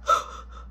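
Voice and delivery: acted gasp after being choked; gasp for air. Kid released by nana, acted gasp for air. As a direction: gulp air as if just released. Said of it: "She let me go. I'm like".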